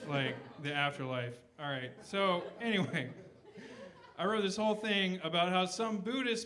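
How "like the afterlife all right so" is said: normal talking voice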